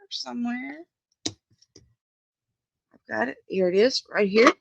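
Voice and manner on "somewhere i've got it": softer still, still searching; muttered to herself. Real, not acted. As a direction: keep mumbling while hunting for it.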